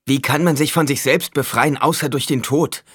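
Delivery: high, loud, urgent challenge; anguished outburst. A narrator's reading. Acted outburst in tense argument. (laut und aufgebracht)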